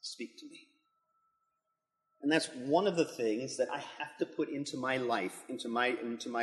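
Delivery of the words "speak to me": hushed, inward prayer fading to a whisper; quiet prayer. Retold or imitated. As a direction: pray softly to the Lord, almost breathing the last words. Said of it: "open it up and say".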